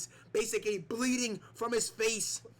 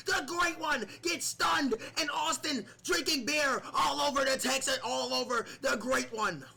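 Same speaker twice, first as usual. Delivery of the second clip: excited commentary; 8 semitones higher, 4 dB louder than usual. Acted. Big wrestling move, commentator erupts.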